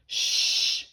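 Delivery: long, forceful shushing hiss; sharp "shh" to silence someone; loud shush. A narrator's reading. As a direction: shush them firmly. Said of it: "shushed Big Tippy".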